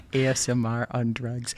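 laughing. Real, not acted. Laughing at the meows.

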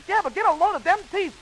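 gawp at the wolf in a high voice; wide-eyed Little Red. A narrator's reading. Red marvels at the wolf's teeth.

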